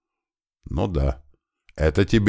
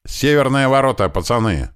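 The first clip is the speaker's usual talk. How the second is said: yelling into radio; 7 semitones higher than usual. A narrator's reading. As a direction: shout it over the radio. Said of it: "заорал Туман в рацию".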